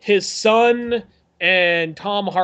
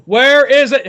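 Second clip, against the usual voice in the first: sharp loud demand; 5 semitones higher, 8 dB louder, slower than usual. Acted. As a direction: bark it as a demand. Demanding question, pointing scene.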